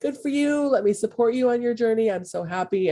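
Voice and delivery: high-pitched imitation of an enthusiastic supporter; supportive cheering. Friends backing you on your healing journey, supportive cheering. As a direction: cheer them on, voice lifted high. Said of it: "There's gonna be people that are gonna".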